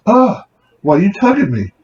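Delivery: drawn-out whine. Retold or imitated. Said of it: "it's all, like, drawn out".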